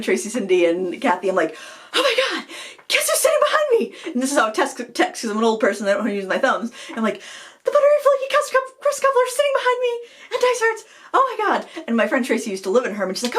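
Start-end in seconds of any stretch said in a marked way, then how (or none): 1.9-4.1 very high excited squeal; freaking out. Retold or imitated. Texting friends about celebrities behind her, freaking out. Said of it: "freaking out". 7.6-11.8 extremely high, starstruck squeal; freaking out. Retold or imitated. Texting friends from Dysart's about celebrities, freaking out. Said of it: "freaking out".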